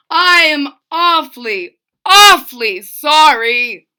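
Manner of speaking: loud, high pleading king; remorseful king. A narrator's reading. Old king calling the boy back, pleading sorry. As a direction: call him back begging forgiveness.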